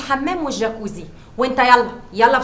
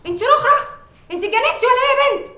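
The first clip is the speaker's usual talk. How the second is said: shrill scolding; 8 semitones higher than usual. Acted. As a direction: put the servants in their place. Madam berating household. (توبيخ متعالي)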